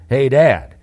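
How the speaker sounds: loud call mid-jump; retold yell. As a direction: yell it while leaping. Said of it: "He had jumped and then yelled".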